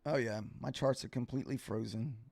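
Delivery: ordinary talk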